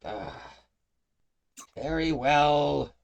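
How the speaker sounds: high, loud, slow surrender; grudging imp. Acted. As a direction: drag it out reluctantly as the imp.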